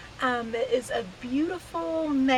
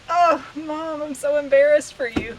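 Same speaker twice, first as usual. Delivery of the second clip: louder, higher mock-mortified son; hammed-up embarrassed kid, raised voice; imitating embarrassed son; 4 semitones higher, 9 dB louder than usual. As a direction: quote a son loudly embarrassed for his mom. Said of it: "He's like".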